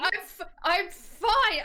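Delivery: high, halting, drawn-out insistence; stammer, then stretch out the claim of being fine; strained reassurance. Acted. Hurt Ari answers a worried friend, strained and high.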